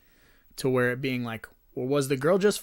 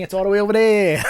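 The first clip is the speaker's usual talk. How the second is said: excited taunting shout; 6 semitones higher, 8 dB louder, faster than usual. Real, not acted. Spider scare, relieved taunt.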